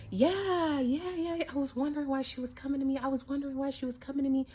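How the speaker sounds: eager agreement. Retold or imitated. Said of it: "everybody's like".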